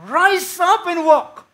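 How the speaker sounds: slow, high, very loud command to rise; commanding shout. Retold or imitated. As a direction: bellow it like a healing command. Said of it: "ikaw ay sumigaw sa, sinigawan mo ako". (malakas at mabagal na sigaw ng utos)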